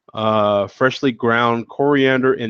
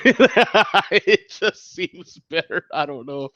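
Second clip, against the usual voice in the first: real laugh breaking through slow words, higher; 6 semitones higher, slower than usual. Real, not acted. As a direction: talk through your own giggles. Laughing at his own impression.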